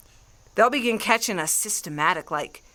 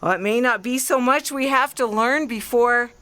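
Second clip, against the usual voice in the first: ordinary delivery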